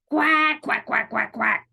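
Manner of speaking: one big quack, then quick ones; quacking duck. Acted. Duck calls to the spider, quacking. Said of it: "called the duck".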